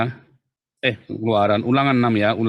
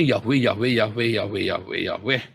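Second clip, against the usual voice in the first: repeated name trailing down into a softer mumble-like chant; mocking repetition; 4 semitones lower, 3 dB quieter than usual. Teacher imitates people who keep saying Yahweh, mocking repetition. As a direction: parrot the name over and over, dropping lower. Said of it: "Nah, orang suka".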